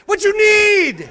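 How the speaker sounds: put-on high, loud, slow Indian accent, teasing; mock rude attendant. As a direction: answer the kids in the attendant's gruff voice. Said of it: "I got on there and went".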